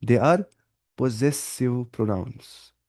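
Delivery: possessive pronouns drawn out very slowly; very slow repeat. Shown as a demonstration. Teacher quizzing then answering for students, very slow. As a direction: dictate it again word by word. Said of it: "क्या, क्या होते हैं वो?".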